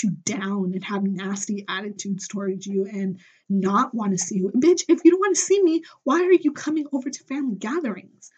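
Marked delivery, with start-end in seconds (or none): 4.5-8.3 much higher and louder, exasperated; raised voice of outrage at a relative; indignant outburst. Real, not acted. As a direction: fire it at them in disbelief. Ranting at estranged relative.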